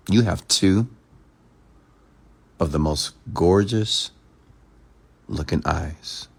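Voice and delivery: slow, pausing before each phrase; slow with pauses. Shown as a demonstration. Coach demonstrating a compliment, slow with pauses. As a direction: let the words hang, slow. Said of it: "you have to notice the pause".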